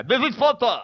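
a playful put-on voice for a catchphrase; mock character voice. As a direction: toss it off in a silly character voice. Doing a character voice bit.

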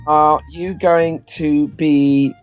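usual speaking voice

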